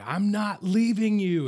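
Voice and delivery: tearful plea. Retold or imitated. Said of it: "and the tears are welling up".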